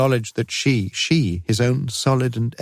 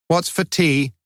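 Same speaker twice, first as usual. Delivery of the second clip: put-on sulky teenage demand, pitched up; a resentful boy grumbling for his tea; truculent; 9 semitones higher, slower than usual. A narrator's reading. Boy home in a mood asks mother, sulky and truculent. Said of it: "His tone of voice suggested ... truculent disfavour".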